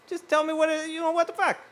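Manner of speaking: high-pitched mock-indignant complaint; mock exasperation. Mock exasperated rant about being corrected.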